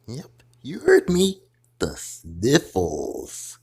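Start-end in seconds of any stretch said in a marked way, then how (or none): 1.8-3.6 slow deep ominous diagnosis; dramatic low. Acted. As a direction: announce it like grave news. Doctor repeats diagnosis.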